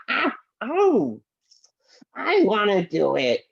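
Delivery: ordinary delivery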